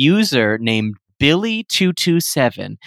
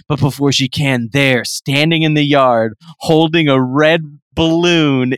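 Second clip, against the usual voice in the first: usual speaking voice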